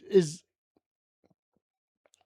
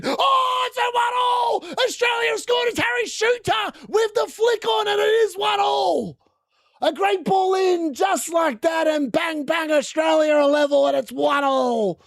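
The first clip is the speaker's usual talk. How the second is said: excited goal shout; 10 semitones higher than usual. Real, not acted. Watching Australia equalise, a high excited goal shout. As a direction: yell it out as the ball hits the net. Equaliser scored, live commentary.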